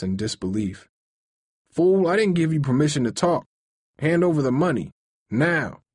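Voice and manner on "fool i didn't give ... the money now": raised, threatening robber's orders; menacing. Acted. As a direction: bark it at the old man. Robber threatens shop owner.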